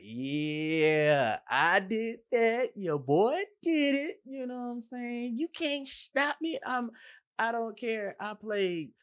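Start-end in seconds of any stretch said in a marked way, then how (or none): 0.0-6.4 much higher and louder, slowed, chest out; puffed-up bragging. Retold or imitated. Cook bragging to himself after a rush, puffed-up and loud. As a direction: crow it like you just won. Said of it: "I puffed my chest on out there like".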